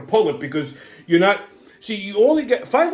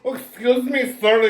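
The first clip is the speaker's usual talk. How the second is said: lightly voiced eager salesperson, higher pitch; higher-pitched mock sales approach; pushy cosmetics seller; 6 semitones higher than usual. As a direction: imitate a seller catching a passerby. Mimics pushy makeup seller stopping him.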